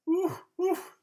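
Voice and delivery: high, loud hooting owl call. Acted. Old owl hooting at the yawning rabbit, high and loud. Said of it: "hooted the owl".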